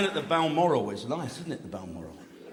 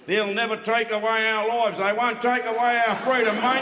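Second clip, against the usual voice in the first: raised, mock-heroic battle speech; badly un-Scottish film hero; 4 semitones higher, 4 dB louder than usual. Mocking a film actor's Scottish hero, raised mock-heroic cry. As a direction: declaim it high and loud, hopelessly un-Scottish. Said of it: "He didn't even try to be fucking Scottish".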